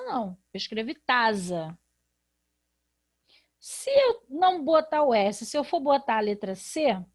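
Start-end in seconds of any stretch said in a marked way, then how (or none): 0.5-1.8 tasa with a z sound in place of the s; wrong reading. Shown as a demonstration. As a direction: read tasa with a buzzing z. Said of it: "eu não escrevi taça".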